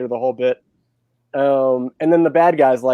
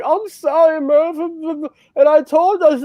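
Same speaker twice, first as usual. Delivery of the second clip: much higher, teary British accent, mock sobbing; crying British Michael Caine; 13 semitones higher than usual. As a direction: ham up a weepy British accent. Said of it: "he's crying the whole time".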